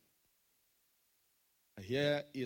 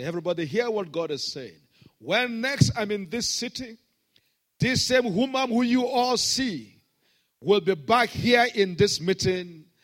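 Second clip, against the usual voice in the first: crowd declaration; 8 semitones higher, 10 dB louder than usual. Retold or imitated.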